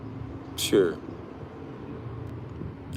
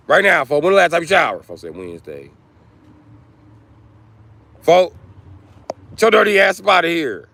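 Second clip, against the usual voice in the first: yelling; 6 semitones higher, 5 dB louder than usual. Acted. Yelling at a dirty man.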